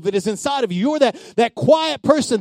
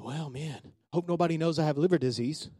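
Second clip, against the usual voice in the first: softer, fast fretful murmur; mock hushed worry; 7 semitones lower, 10 dB quieter than usual. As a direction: mutter it like hiding a secret. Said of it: "And I wouldn't stay home going".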